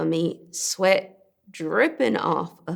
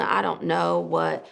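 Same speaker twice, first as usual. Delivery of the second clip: ordinary talk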